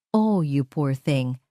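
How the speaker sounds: slow, syrupy false sympathy; fake pity. A narrator's reading. To the nanny Ara, Isabel coos in slow fake pity. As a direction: coo it with mock concern. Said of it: "na may huwad na awa sa boses".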